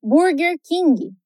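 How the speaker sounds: ordinary talk, nothing marked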